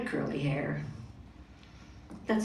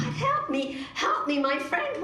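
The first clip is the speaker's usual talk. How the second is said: pleading; 8 semitones higher than usual. Acted. Morgan begging a stranger.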